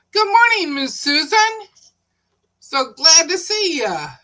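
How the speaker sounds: greeting Miss Susan in the chat, high and bright with joy; excited greeting. Real, not acted. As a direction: sing out a big hello to a friend. Greeting a viewer on stream.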